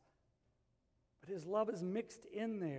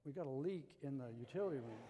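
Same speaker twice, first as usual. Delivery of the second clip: mock calm report; 6 semitones lower, 7 dB quieter than usual. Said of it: "she comes into my bedroom and goes".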